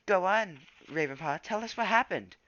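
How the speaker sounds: high, loud pleading call; eager call. A narrator's reading. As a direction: call out for the story.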